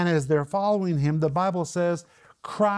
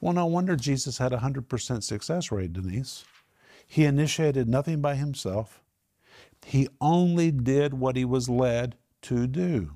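ordinary delivery

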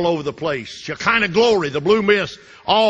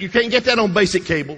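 usual speaking voice

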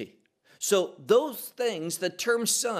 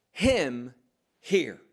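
"Him" set apart and firm, a beat before "hear"; stress on HIM; 3 semitones lower than usual. Shown as a demonstration. Preacher reading the voice from the cloud, "Him" emphatic. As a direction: land hard on "Him", pause, then "hear". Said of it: "There's an emphasis on that word him".

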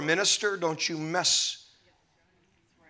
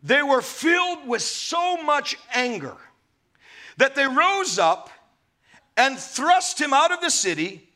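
impassioned reading; 6 semitones higher than usual. Acted. Preacher reading the crowd's anger.

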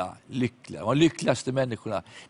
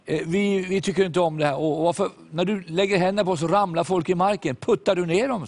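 higher, challenging voice of a local tough; a mildly mocking voice of the youths; taunting youth; 7 semitones higher than usual. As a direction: jeer at the preacher. Hostile youths confront the preacher.

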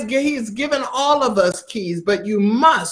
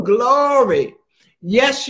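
ordinary delivery

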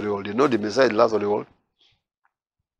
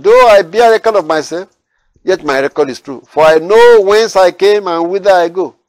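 higher, louder proclaiming of the verses; preaching at full force; 5 semitones higher, 7 dB louder than usual. Acted. Preacher proclaiming Jesus' answer to the Pharisees, forceful. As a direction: lift the words of Jesus over the church.

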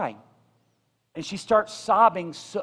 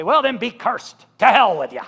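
a hammed-up dismissive curse, louder at the end; mock curse; 3 semitones higher, 6 dB louder than usual. Pastor imitates Amber cursing an angry stranger, mock scorn. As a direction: fling it away like you're done with them. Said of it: "like Amber did".

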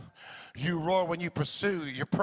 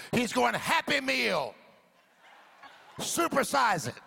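full-force preaching; 6 semitones higher than usual. Real, not acted. Climactic preaching over music.

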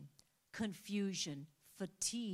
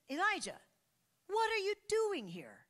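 "what" hit high and sharp, a louder demand; God calling out; 14 semitones higher, 8 dB louder than usual. Retold or imitated. God confronting Elijah in the cave, sharp demand. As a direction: question him like a stern Lord. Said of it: "And he said".

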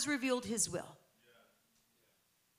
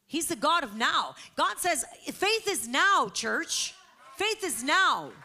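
loud, raised preacher's declaration; emphatic, high-pitched pulpit proclamation; full-force preaching; 6 semitones higher, 6 dB louder than usual. Acted. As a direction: drive each phrase home to the congregation.

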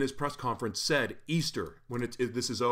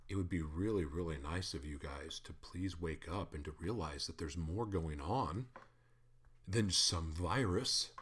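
mock-polite, much softer, slowing at the end; politely; 5 semitones lower, 6 dB quieter than usual. Shown as a demonstration. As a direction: hush down and plead sweetly after the yelling. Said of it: "So let me politely say it like this".

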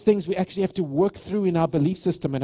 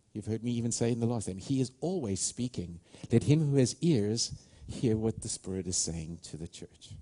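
low, hushed preacher inviting prayer; quiet ministry-time invitation; soft altar call; 7 semitones lower, 6 dB quieter than usual. Real, not acted. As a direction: draw them gently toward the Spirit.

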